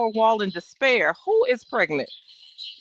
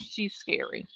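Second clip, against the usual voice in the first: breathy whisper, voice dropped away; whispered aside; 10 dB quieter than usual. Real, not acted. Whispering to listeners that the woman is scary. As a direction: whisper it like a secret.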